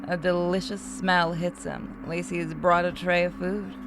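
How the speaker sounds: normal talking voice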